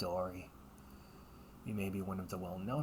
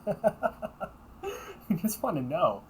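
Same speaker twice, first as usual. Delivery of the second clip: slow words through laughter, pitched up; laughing; 9 semitones higher, 6 dB louder, much slower than usual. Acted. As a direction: giggle while teasing back the line. Laughs mimicking sibling.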